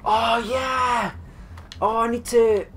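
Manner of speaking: triumphant yell; excited shout. Real, not acted. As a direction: cheer out the win. Celebrating the loot.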